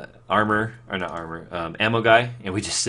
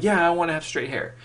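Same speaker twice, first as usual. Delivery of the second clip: put-on much higher, childlike eager voice; eager kid self; 9 semitones higher than usual. Vlogger voices his younger self wanting straight hair, eager high. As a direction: play your thirteen-year-old self wanting it badly. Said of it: "I'm like".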